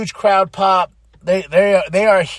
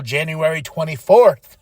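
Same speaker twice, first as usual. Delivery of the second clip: normal talking voice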